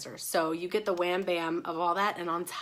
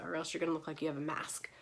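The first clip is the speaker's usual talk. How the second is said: whispering; 2 semitones lower, 8 dB quieter, faster than usual. Real, not acted. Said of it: "whispered aside to viewers".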